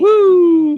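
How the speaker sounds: high whooping cheer; loud woo. Real, not acted. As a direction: let out a big whoop.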